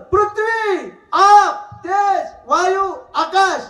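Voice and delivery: ringing proclamation slowing to the end; proclaiming the elements. Shown as a demonstration. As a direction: announce each element like a chant. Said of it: "elements proclaimed again".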